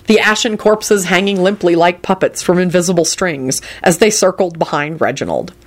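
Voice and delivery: ordinary delivery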